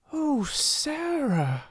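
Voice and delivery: high, slow, drawn-out oh; sudden inspired cry; mild eager cry. A narrator's reading. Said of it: "she cried".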